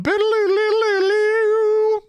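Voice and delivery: high, slow, sung-out noise effect; silly sound effect. Retold or imitated. Mocking the Batmobile harpoon with a silly high sound effect. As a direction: imitate the harpoon firing with a silly warble.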